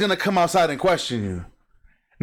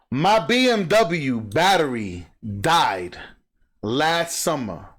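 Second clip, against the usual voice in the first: slow emphatic telling; 3 semitones lower, slower than usual. Real, not acted. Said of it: "repeats it slowly".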